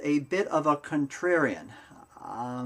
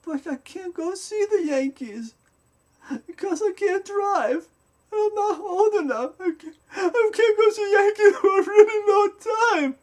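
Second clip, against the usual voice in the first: put-on crying little boy; 5 dB louder than usual. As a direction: imitate a six-year-old sobbing in despair. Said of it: "Peter turns to me and goes".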